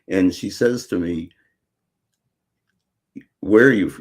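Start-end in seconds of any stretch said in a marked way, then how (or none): 3.4-4.0 a light imitation of a classmate's Queens accent, said louder, breaking off on "Where are you f-"; mock Queens accent on "Where are you f-", with a broad open "Where". Freshman girl asking the new kid where he's from, in a Queens accent. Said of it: "Queens accent".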